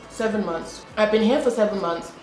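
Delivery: normal talking voice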